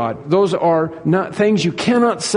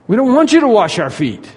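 higher-pitched mock objection; acted protest; 5 semitones higher than usual. Disciples refusing to have their feet washed, in protest.

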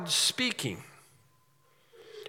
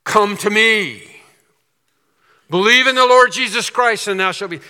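booming voice from heaven; 3 semitones higher, 7 dB louder than usual. Pretend. Preacher playing God's call to the congregation, booming. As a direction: boom it out like God calling from heaven. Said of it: "a booming voice from heaven saying".

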